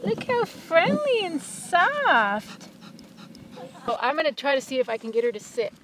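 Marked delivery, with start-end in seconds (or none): 0.0-2.7 high, slow cooing over a sweet dog. Real, not acted.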